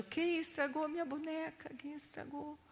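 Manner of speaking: imitated crying. Sister finding her ruined doll, mock crying. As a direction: imitate a little sister's tearful complaint. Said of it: "minha irmã veio chorando".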